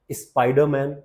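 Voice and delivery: ordinary talk, nothing marked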